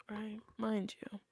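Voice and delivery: ordinary talk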